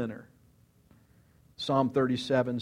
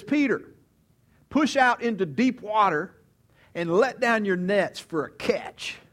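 higher, louder call across the boat; commanding Jesus; 6 semitones higher, 4 dB louder than usual. Retold or imitated. Jesus calls to Peter from the boat, higher and louder.

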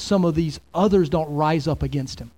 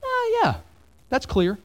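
sarcastic squeak on oh, yeah; mock sarcastic; 13 semitones higher than usual. Pastor mocking an easy reading, sarcastic. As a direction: pretend it all makes easy sense, mockingly. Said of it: "I don't look at that and go".

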